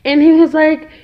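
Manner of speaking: ordinary talk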